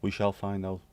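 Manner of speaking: ordinary delivery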